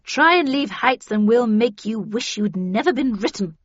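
loud, menacing warning; growled threat. A narrator's reading. Havisham warns Heathcliff not to leave Heights, growling. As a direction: threaten forcefully with a touch of growl. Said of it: "growled Havisham".

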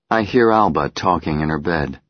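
ordinary delivery, nothing marked

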